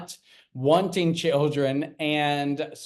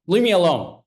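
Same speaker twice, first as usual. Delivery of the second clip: louder, curt mock snap of an irritated father; annoyed dad; 3 semitones higher, 7 dB louder, faster than usual. Trad dad snapping at his pestering child, mock annoyed and louder. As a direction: brush the kid off sharply, play-acted.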